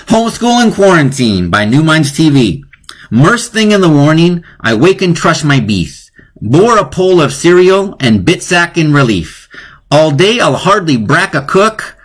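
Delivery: poem recital. Shown as a demonstration. Recites whole poem to class.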